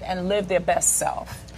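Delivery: normal talking voice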